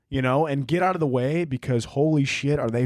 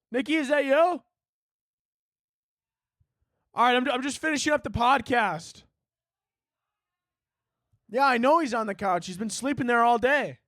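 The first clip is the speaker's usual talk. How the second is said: high, singsong call across the house; 10 semitones higher than usual. Real, not acted. Podcaster talking to someone off mic, calling out high. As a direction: answer someone in another room, pitched high.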